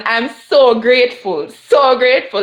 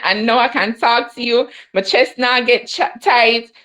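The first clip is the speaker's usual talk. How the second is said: usual speaking voice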